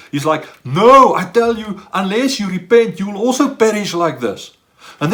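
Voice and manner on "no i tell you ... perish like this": a loud, firm "No" that drives the warning home; emphatic. Retold or imitated. Jesus warning the crowd that they must repent, emphatic and loud. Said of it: "Jesus is very emphatic here".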